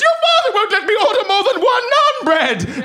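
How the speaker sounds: high, laughing tearful mother's complaint; wailing mother. Retold or imitated. Tearful mother complaining to her son, high hammed-up wail. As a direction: wail it like a distraught mum. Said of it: "She goes".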